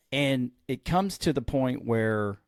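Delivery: ordinary talk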